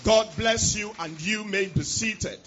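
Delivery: normal talking voice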